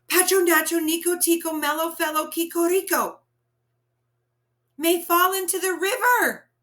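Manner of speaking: high, loud rush of the long name; urgent blurted name. Acted. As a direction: spill it all out in one panicked breath. Boy blurts brother's long name.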